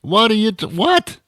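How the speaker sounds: what shooting up very high; incredulous what. Acted. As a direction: squeak out a stunned what. Baffled at drive-through speaker.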